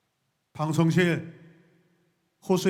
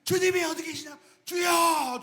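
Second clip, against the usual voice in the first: higher panicked yelling; frantic search amid chaos; chaotic yell; 12 semitones higher than usual. Acted. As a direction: disciples yelling in the storm. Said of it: "막 난리를 쳤어요".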